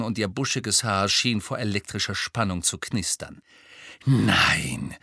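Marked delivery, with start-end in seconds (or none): 4.0-5.0 low, louder, furious "Nein"; seething, barely held back rage; trembling with anger. A narrator's reading. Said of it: "sagte sie mit zornbebender Stimme".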